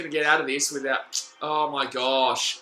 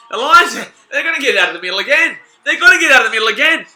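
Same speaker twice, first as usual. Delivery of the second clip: raised, loud match-watching urgency; alarmed live reaction; 7 semitones higher, 11 dB louder, faster than usual. Real, not acted. Fan watching rivals break out of the middle, alarmed. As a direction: call it out high and loud.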